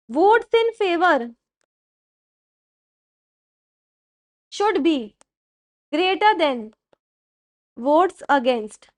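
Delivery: word by word, very slow, for students to write; spaced-out dictation pace. Shown as a demonstration. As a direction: dictate each phrase slowly with pauses. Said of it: "slow dictation of the rule".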